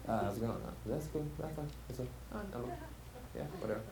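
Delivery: barely bother to say it; mumbled grunting. Retold or imitated. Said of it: "Is it just grunting?".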